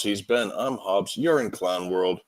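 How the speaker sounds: ordinary talk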